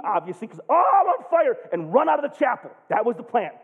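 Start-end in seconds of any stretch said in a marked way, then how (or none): 0.7-1.6 high mock-panicked cry; mock scream. Burning guard runs from the furnace, mock scream. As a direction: act out a scream, pitched high. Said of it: "and scream".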